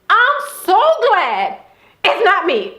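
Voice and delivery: relieved private glee, half laughing; gleeful inner thought. Retold or imitated. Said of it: "going through my head was".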